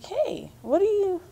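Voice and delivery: put-on high-pitched British accent, retelling a protest. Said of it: "in my British accent".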